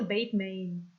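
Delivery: "Batman" said as BAYT-mayn; Bateman pronunciation. Shown as a demonstration. Streamer reminding viewer of the name, "Batman" pronounced BAYT-mayn. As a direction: give "Batman" long A vowels, like Bateman. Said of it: "Not Batman".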